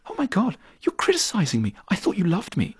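hurt partner. Retold or imitated. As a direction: play the offended partner lightly. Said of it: "the partner turns around and goes".

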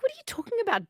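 high-pitched, acted-out disbelief; mock-indignant question, pitch jumping up; incredulous retort. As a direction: play the baffled parent objecting. Acts out arguing with her daughter.

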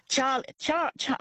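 halting, aborted try at Scottish; attempted Scottish accent. Shown as a demonstration. Caller asked to give some Scottish, halting attempted Scots accent. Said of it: "can you give us some Scottish".

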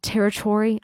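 "territory" as terri-TOR-ee; "territory" ending like "Tory"; disputed pronunciation. Shown as a demonstration. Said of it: "I just don't believe it".

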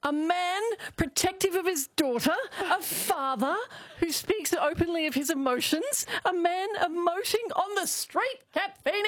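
high, overexcited swooning; worked up and pitched high; swooning gush. Pretend. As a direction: gush like a smitten fan. Gushing over the actor's line.

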